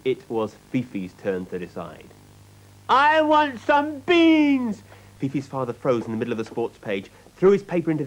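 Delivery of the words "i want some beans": put-on high, slow whining child demanding beans; whiny little girl. A narrator's reading. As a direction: stretch it out like a spoilt little girl. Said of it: "whined Fifi".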